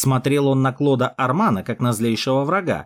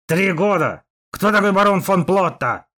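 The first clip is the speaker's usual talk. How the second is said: angry shout; 6 semitones higher, 4 dB louder than usual. A narrator's reading. Duke clenches fists, shouts.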